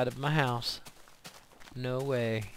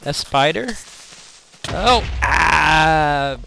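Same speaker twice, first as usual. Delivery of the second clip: startled scream; 3 semitones higher, 13 dB louder, much slower than usual. Real, not acted. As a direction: yelp in sudden fright. Startled by a spider.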